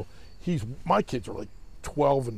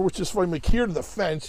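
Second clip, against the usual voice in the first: ordinary talk, nothing marked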